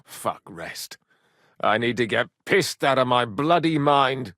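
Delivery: normal talking voice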